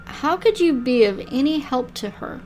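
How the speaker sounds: ordinary talk, nothing marked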